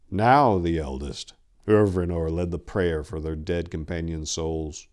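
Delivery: plain reading voice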